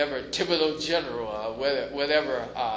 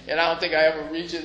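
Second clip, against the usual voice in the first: usual speaking voice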